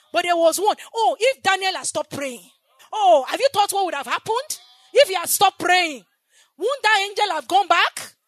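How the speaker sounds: loud, high, full-force preaching; fiery preaching. Acted. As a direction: preach it out to the whole church.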